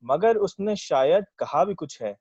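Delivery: verse lifted above everyday talk; ghazal recitation. Acted. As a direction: present the couplet to a listening mushaira. Recites own ghazal at mushaira.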